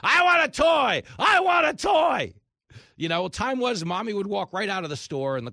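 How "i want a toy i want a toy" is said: shrill tantrum shout, much higher and louder; screaming toddler. Retold or imitated. Host imitating a kid screaming in the store. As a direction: wail it like a spoiled kid on the floor. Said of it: "kicks and screams".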